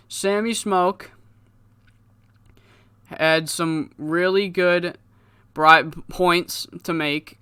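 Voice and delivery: slow, stumbling speech with long pauses; halting promo. Acted. Crippler addresses the crowd on Sammy Smoke's behalf, slow halting delivery. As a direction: labor through it like badly remembered lines. Bribed wrestler fumbles a promo.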